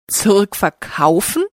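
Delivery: mildly surprised, with rising end; surprised question. A narrator's reading. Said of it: "fragt der Detektiv überrascht". (leicht überrascht nachgefragt)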